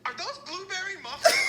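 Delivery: eager high question about muffins; light up at the muffins; bright eager question; excited. Retold or imitated. Excited stoner asking clerk.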